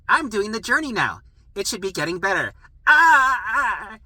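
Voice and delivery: hammed-up breaking cry; frustrated wail. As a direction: whine that it should be better by now. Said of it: "That's what it's like".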